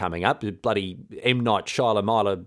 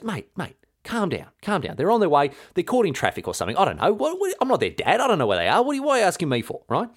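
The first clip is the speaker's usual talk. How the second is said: high, exasperated brush-off, some of it rushed; mock innocent Olga; 6 semitones higher than usual. Olga fobbing off the Drevlians, high mock-innocent exasperation. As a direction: play dumb and wave the question away. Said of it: "And Olga goes".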